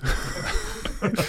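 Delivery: airy rising laugh; a silent wheezing laugh; breathy giggle at a mate; breathless giggle. Real, not acted. Laughing at awkward host.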